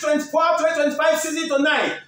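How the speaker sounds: upbeat pilot-style announcement, slowed; radio announcer. Acted. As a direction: hype the flight to Europe like an airline captain. Radio host's airline-style sports link.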